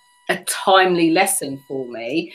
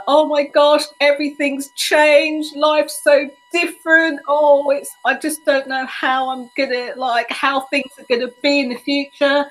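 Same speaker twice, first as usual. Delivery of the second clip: imitating sobbing friend; 9 semitones higher than usual. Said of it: "She was sobbing and she's like".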